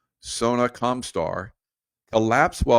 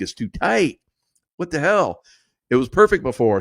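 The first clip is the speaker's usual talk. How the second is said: mock alarm; 5 semitones higher, 4 dB louder than usual. Retold panic in the water.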